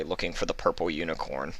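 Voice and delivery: plain reading voice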